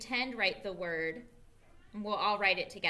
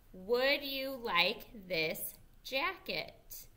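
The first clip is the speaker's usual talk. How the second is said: teacher reading along slowly with the class; slow choral reading; slower than usual. Shown as a demonstration. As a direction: read it slowly together. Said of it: "Read it with me".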